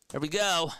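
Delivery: ordinary delivery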